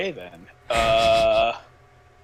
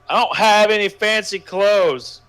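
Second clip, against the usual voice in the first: loud, slow call upstairs; yelling; 8 semitones higher, 8 dB louder than usual. A narrator's reading. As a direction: yell it down the stairs. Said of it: "he yelled down the stairs".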